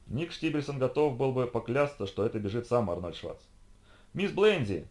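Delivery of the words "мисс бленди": higher, eager call. A narrator's reading. Robot running up to Jenny, calling out. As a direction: call out to her as you run up. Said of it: "закричал Арни".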